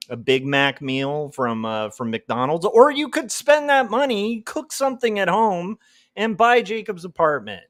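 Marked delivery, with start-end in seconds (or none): 2.7-7.6 louder, higher pitch voice; hammed-up sales pitch. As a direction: sell it with mock excitement. Pitching his book, louder.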